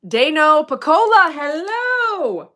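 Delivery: high, loud drawn-out hello; hailing greeting. Real, not acted. Streamer greets a viewer by name, calling out high and loud. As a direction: sing out the greeting.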